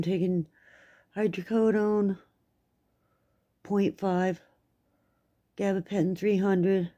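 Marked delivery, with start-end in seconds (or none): none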